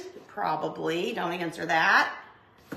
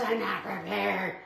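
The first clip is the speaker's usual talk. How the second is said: softer, muttered to herself; resigned muttered aside; quiet mutter; 5 dB quieter than usual. Real, not acted. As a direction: mumble it under your breath. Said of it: "repeats the line, then mutters it".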